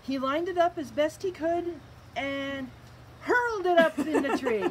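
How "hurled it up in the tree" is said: higher, louder, breaking into a real laugh; laughing while reading. Real, not acted. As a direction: read through your own giggles.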